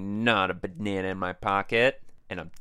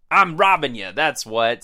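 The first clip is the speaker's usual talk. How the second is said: loud, raised-pitch outburst; forceful robber; 4 semitones higher, 7 dB louder than usual. Acted. Robber reminding two kids of the holdup, forceful. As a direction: assert the threat loudly.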